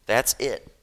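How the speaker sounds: loud, punchy statement; emphatic declaration. Real, not acted. Preacher sums up his point, emphatic and loud. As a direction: land it hard and loud.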